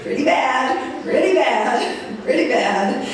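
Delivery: slow, laughing repeat; chuckling as she admits it. Real, not acted. The speaker tells the audience through laughter that it was bad.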